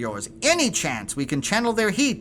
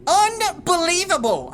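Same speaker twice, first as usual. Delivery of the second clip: normal talking voice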